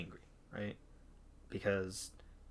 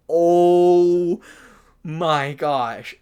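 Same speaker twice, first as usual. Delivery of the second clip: loud, long-held high "oh"; mock-exasperated wail; exasperated outcry; 5 semitones higher, 10 dB louder, much slower than usual. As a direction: hold the first word loud, then drop. Acted reaction to customer's remark.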